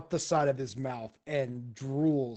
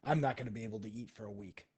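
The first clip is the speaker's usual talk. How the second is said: nervous mutter; 4 semitones lower, 8 dB quieter, faster than usual. Retold or imitated. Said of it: "he's like".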